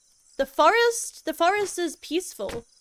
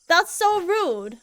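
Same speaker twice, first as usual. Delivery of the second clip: ordinary talk, nothing marked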